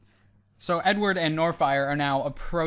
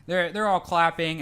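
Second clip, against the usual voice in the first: ordinary delivery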